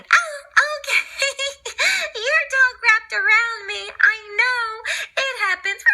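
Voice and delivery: high lady voice. Acted. Anita laughs off the tangled leash to Roger, high giggly voice.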